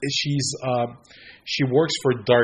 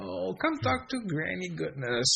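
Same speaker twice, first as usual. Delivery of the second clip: put-on higher, softer, sugary old granny voice; sweet evil granny; 7 semitones higher, 7 dB quieter than usual. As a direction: lure them in like a false kindly grandmother. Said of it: "But she's, she starts like".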